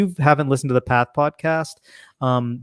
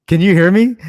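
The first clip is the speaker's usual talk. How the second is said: calling out; 6 semitones higher, 7 dB louder than usual. Real, not acted. Calling to someone wearing helmet.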